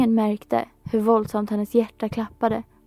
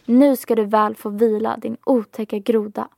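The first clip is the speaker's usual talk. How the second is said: ordinary delivery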